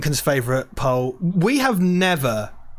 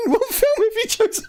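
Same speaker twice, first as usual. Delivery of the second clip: high, squeaky laughing question; helpless giggle through the words. Real, not acted. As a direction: barely get the question out for laughing. Cracks up while asking the question.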